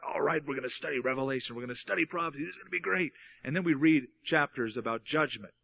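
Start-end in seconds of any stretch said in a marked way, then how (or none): 0.0-3.1 quick, softer imitation of keen students; understated, rushed enthusiasm, imitating others; mock excitement. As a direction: lightly act out eager anticipation in a hurried undertone. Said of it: "usually there's an excitement".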